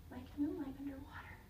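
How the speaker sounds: hushed whisper; soft whispered sadness. Acted. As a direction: let it fade into a whisper. Dimming jar, sad story moment.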